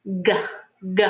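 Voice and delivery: hard "G" from the back of the throat; over enunciating. Shown as a demonstration. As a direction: spread the mouth and say "G" slowly. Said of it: "spreading my mouth a little wider".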